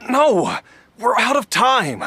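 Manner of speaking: loud, high cry of refusal, slowing on the second line; desperate shout. Acted. Jia Liang refusing to rest, shouting at the doctor. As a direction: shout him down, frantic.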